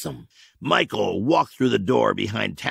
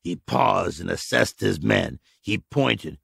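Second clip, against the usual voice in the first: usual speaking voice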